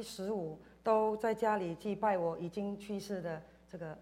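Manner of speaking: usual speaking voice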